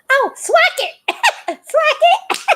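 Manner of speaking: high teasing singsong, laughing through it; mocking singsong. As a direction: taunt him with the wrong name, high and playful. Embryo mocking the name.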